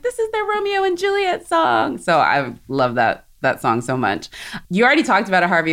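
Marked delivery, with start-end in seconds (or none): 0.0-2.0 dreamy inner voice. Retold or imitated. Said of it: "I was always just like".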